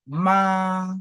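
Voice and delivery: MA held high and level; constant first tone. Shown as a demonstration. Teacher showing students the constant first tone, high level MA. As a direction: sing out MA on one high, steady, long pitch. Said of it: "C'est constant".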